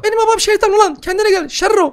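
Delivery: a tiny brat swearing; squeaky baby voice. Pretend. Gamer voices the demon baby cursing him, squeaky.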